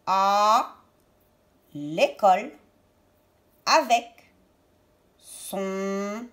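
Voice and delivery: slow reading with a pause after each word. Shown as a demonstration. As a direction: sound out each word separately, like early reading. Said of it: "phrase que nous avons appris à lire".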